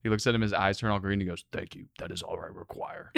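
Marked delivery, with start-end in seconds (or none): 1.5-3.1 softer, lower, deadpan alien. Retold or imitated. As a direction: say it flat and otherworldly. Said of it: "That voice".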